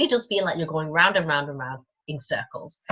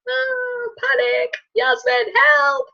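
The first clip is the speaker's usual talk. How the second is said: very high squealing cry for help; hammed-up panicked plea; mock panic; much slower than usual. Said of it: "sitting there going".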